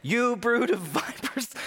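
high hammed-up denunciation with a laugh; mock fiery rebuke. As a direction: scold like an over-the-top prophet. John voiced rebuking crowd.

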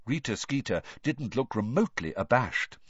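ordinary delivery, nothing marked

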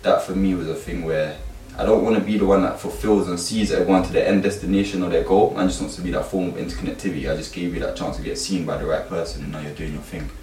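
normal talking voice

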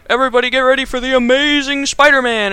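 loud, high-pitched announcer's call; big put-on arena introduction; mock ring announcer. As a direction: hype up the crowd like a ring announcer. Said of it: "he's the announcer in this one".

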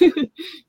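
a quick high laugh at her own joke; amused little laugh after the joke; short laugh. Real, not acted. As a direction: laugh it off lightly.

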